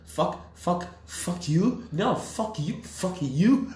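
high hammed-up swearing match; mock dub shouting. Mocking the dub's duel of insults, high and hammed-up. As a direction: overplay a clumsy dubbed brawl. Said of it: "English Indians just going at it".

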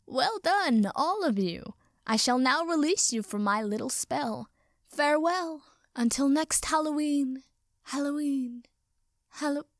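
high, theatrical wizard's farewell fading in echoes; pompous, sing-song blessing from a wizard; benevolent wizard. A narrator's reading. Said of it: "The wizard smiled benevolently".

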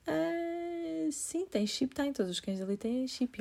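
high, breezy fake reassurance; forced casual reply. She nervously reassures the stranger about the chip, high and breezy. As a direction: brush it off a bit too brightly.

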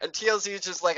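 usual speaking voice

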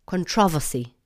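"Controversy" with -vossy ending, stress on trov; mock the English "Controversy". Shown as a demonstration. Imitating the English "Controversy" to mock it. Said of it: "turned the last part of the word into vossy".

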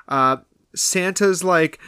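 ordinary talk, nothing marked

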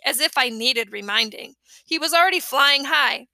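ordinary delivery

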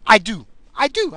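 high, loud, tongue-tied outburst; enraged. Real, not acted. Host suddenly remembering his top five list, enraged. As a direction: stumble over the words in mounting rage. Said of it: "I just got so enraged".

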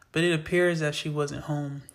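ordinary delivery

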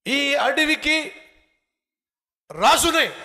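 high, slow, booming boast; loud, drawn-out royal claim; boastful proclamation. Acted. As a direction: proclaim it loudly, word by word. Boast of being forest king.

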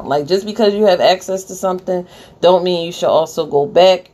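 ordinary delivery

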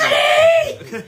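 drawn-out excited cry; excited shout. Real, not acted. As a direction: call it out with delight. Excited at seeing curry.

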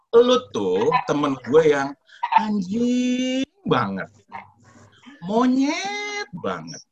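drawn-out, high singsong insult; insults stretched out high and sweetly; politely sweet swearing. Retold or imitated. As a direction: coo the swear words slowly and nicely. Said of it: "kan tuh ngomongnya baik-baik".

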